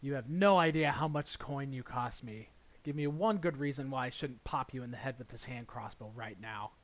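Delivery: raspy threatening voice from above; gravelly sly hobgoblin. Acted. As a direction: growl it out like a sly goblin. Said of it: "You hear a raspy voice".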